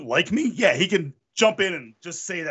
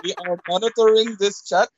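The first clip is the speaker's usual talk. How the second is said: high, put-on agent warning; FBI agent voice; 5 semitones higher than usual. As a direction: play a fake agent monitoring chat. Mock FBI agent on call.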